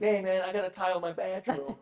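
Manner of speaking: breezy, laughing excuse; imitating Mark. Host mimicking Mark skipping showings to tile, laughing. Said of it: "He's like".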